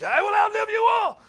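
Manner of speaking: shouted high and loud, gloating; mock defiant shout. Pastor acting out his young self's boast, shouting. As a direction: yell it like a vow of revenge.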